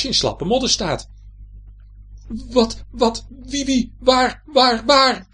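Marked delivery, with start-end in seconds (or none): 2.3-5.3 high, loud panicked stammer; high-pitched frightened stutter; startled stuttering. A narrator's reading. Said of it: "stottert Joris".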